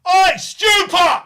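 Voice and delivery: long, high, loud yell across the room; heckling shout. Retold or imitated. Teen fans heckling an actor in the interval, loud yell. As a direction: bellow it like a drunk teenage heckler. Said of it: "And we just kept going".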